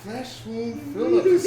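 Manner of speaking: high and slow, savoring every word; amused drawn-out name. Real, not acted. Host repeats the DJ's funny name, slow and high. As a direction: stretch the name out with delight.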